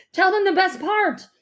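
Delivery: loud, high, excited interruption; eager urging. A narrator's reading. Dad urging mom to share the good news, excitedly. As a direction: burst in, impatient to share.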